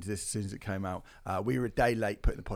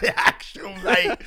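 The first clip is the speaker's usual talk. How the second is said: high, loud, laughing echo; laughing mock repeat; 8 semitones higher, 9 dB louder, slower than usual. Real, not acted. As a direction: repeat it through laughter, pitched right up and drawn out. Laughing mock repeat of other host.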